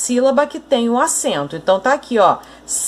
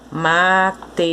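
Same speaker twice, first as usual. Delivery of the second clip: syllable by syllable; 4 semitones lower than usual. Shown as a demonstration. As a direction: say "Matemática" as ma, te, má, ti, ca, pausing between them. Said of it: "ela está separada em sílabas".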